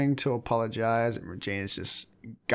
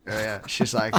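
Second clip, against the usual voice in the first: normal talking voice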